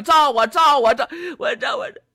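扯着嗓子高喊，越喊越高; 拔高嗓门连声呼喝. Acted. 法宝发动的叫喊. (法宝发威，高声连喊)

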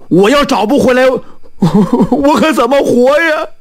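high, anxious pleading; distraught blacksmith. Acted. Blacksmith begs fortune-teller to find his lost silver, distraught. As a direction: voice climbing into an acted wail.

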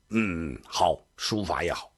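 a hint of a low, quiet emperor; approving emperor.

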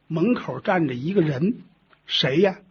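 ordinary delivery, nothing marked

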